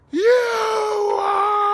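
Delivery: high, loud crying shout; yelling. Retold or imitated. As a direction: scream it out, upset and crying. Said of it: "my daughter starts yelling".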